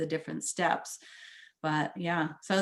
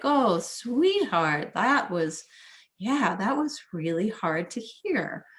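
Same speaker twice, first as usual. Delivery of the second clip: slow, higher, tender self-soothing; soothing sweetheart; 3 semitones higher, 3 dB louder, slower than usual. Shown as a demonstration. Coaching listeners, soothing oneself in a tender voice. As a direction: coo to your hurt self like a loving mama. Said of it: "you're going like this to yourself".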